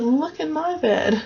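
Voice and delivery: high, slow little bear voice; baby bear telling mom and dad his chair is broken. Acted. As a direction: lift the pitch and play the small cub. Baby bear speaks again.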